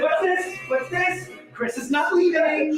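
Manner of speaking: high playful shout; excited shout. Real, not acted. Host shouting back at co-host, playful and high. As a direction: yell it out like a game-show host.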